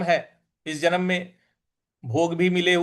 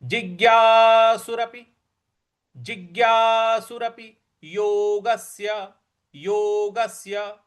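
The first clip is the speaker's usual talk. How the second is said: slow, raised recitation of जिज्ञासुरपि योगस्य; teacher models the phrase; 3 semitones higher, slower than usual. Shown as a demonstration. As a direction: recite slowly for the class. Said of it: "इस प्रकार से बोलेंगे".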